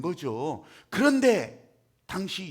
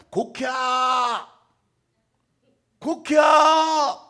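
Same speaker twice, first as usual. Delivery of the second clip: very slow, louder, higher drawn-out yell; mock onlooker shout; 4 semitones higher, 10 dB louder, much slower than usual. Onlookers urging the mourners to wail, slow loud yell. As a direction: bellow it like a nagging crowd. Onlookers demand ritual wailing.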